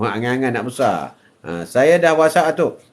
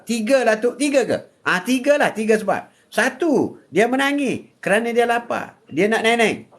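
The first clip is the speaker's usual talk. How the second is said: raised, sing-song preaching voice; 8 semitones higher than usual. Acted. Grandfather preaching to live viewers, raised and lively. Atuk explaining, still pitched up.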